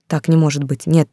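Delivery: plain reading voice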